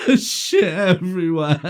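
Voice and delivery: loud, high, helpless with laughter; laughing mock wail. Son confessing the mess to dad, loud laughing wail. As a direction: wail it while cracking up. Son's confession, teller cracking up.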